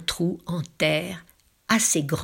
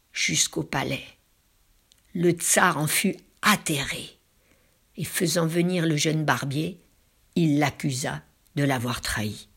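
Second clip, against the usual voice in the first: plain reading voice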